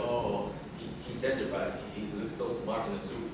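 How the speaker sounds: usual speaking voice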